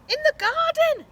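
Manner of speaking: put-on very high, loud little boy. A narrator's reading. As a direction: chime in as the youngest. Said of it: "said Luke".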